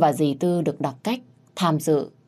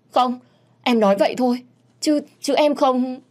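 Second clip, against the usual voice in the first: high, stammering tearful protest; frightened girl denying through tears; tearful denial; 8 semitones higher, 3 dB louder than usual. Acted. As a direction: deny it in a panicked rush. Hồng flustered, denying in tears.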